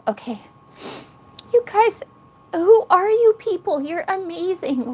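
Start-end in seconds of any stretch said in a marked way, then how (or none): 1.5-4.9 high, tearful gratitude; emotional, overwhelmed. Real, not acted. Streamer moved by her kind viewers, tearful and high. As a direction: voice rising with real emotion. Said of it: "got emotional just now".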